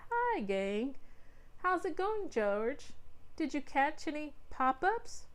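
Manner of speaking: put-on a cheerful mother greeting the kids; mom's voice. A narrator's reading. Mom voiced in story.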